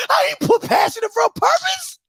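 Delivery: high, wailing mock sob; fake-cry it, voice up high; hammed-up crying plea. Mock crying over coach's speech.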